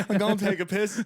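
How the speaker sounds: high, loud mock excuse through laughter; laughing punchline. Teller quoting his friend's escape excuse, laughing punchline. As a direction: blurt the excuse, cracking up.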